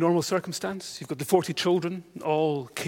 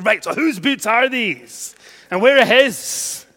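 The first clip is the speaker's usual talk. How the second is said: exasperated; 3 semitones higher, 6 dB louder, slower than usual. Retold or imitated. Exasperated inner thought.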